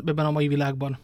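plain reading voice

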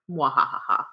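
slow evil villain laugh; mock evil chuckle; scheming. Villain laugh after claiming podcast.

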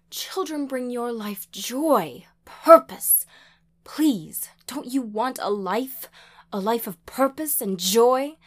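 high, loud, urgent plea; imploring, distraught and insistent; tearful begging. A narrator's reading. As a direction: beg your child desperately. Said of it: "she begged, tears streaming down her cheeks".

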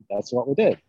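ordinary talk, nothing marked

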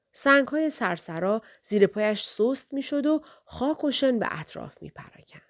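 usual speaking voice

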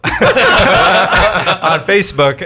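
loud laughing, slowed down. Real, not acted. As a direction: laugh loudly through it. Laughing while telling story.

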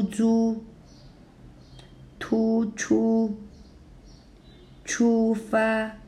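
出租, 突出, 出发 each with two high level tones, clearly spaced; first tone pairs. Shown as a demonstration. Teacher modelling 出租 for learners, both syllables high and level. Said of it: "So first plus first".

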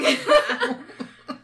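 sharp burst of laughter; loud laugh. Real, not acted. Laughing at red flag.

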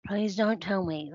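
ordinary delivery

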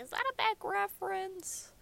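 high, soft quoted therapist; high-pitched hushed imitation; therapist's question. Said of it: "She's like".

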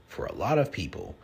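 normal talking voice

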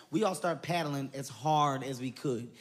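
usual speaking voice

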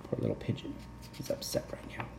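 soft whispered aside; whispering. Real, not acted. Aside to listener.

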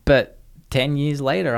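usual speaking voice

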